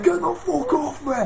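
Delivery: high yelled mock shout; retold mock yell. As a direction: yell it like a kid fighting the police, half laughing. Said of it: "He's going".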